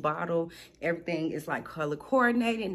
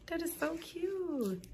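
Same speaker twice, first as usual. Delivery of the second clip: cooing; 9 semitones higher, 7 dB quieter, slower than usual. Real, not acted. Cooing over packaging.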